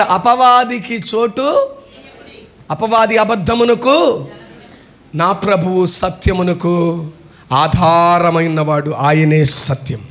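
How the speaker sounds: slow, deliberate reading of the verse; scripture reading. Acted. Preacher reading the next verse to the congregation, slow deliberate voice. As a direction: weigh each phrase slowly. Reads further verse slowly.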